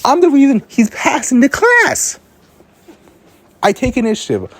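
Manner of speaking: really annoyed at the lazy teammate; pissed off. Real, not acted. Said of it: "so I'm pissed".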